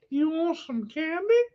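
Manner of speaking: high-pitched creepy candy-offerer; high, unsettling comic come-on; funny creepy voice. Acted. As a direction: put on a silly, high creep voice. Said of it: "unless I'm talking in a funny voice".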